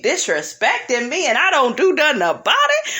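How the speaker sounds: hammed-up cry of a cheated woman; mock outraged wife. Cheated wife venting to the camera, mock outraged wail.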